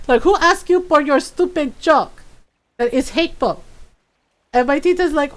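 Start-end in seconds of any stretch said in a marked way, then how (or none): 0.2-3.6 mom's indignant scold. Retold or imitated. Mom scolding tita retold.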